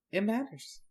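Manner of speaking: hushed echo. Pretend. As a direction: repeat "It matters" as a faint echo. Softer echo of the repeat.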